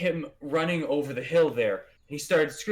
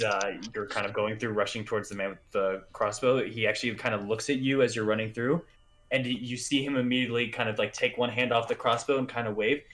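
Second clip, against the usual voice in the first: ordinary delivery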